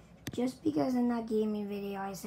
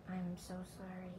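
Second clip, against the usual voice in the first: soft mock-contrite sorry; quiet apology; 3 semitones lower, 10 dB quieter than usual. Youtuber to fans, soft fake-contrite apology. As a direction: murmur the apology softly, eyes down.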